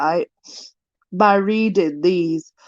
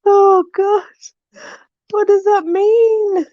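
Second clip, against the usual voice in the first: much higher, louder, teary-laughing question; laughing despair; 11 semitones higher, 4 dB louder, slower than usual. Real, not acted. Vlogger laugh-crying at a rhyme she can't understand. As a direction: laugh till you almost cry.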